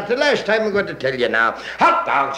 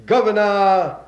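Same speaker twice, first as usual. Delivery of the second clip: breathless radio play-by-play of a parade; parade radio announcer; 2 semitones lower than usual. Acted. Announcer describing the parade to radio listeners, eager broadcast voice. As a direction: sell the parade to the listeners at home.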